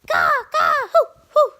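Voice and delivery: high, excited bird voice; bird puppet voice. Pretend. The bird puppet showing off, high and excited. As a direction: squawk it up high like a cocky bird. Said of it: "what was that last part?".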